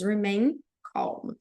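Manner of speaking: "Remain calm" slow and clear; model pronunciation. Shown as a demonstration. Teacher modelling the cabin command for students: slow, clear. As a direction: say "Remain calm" slowly, syllable by syllable. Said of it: "a pronúncia do remain fica assim".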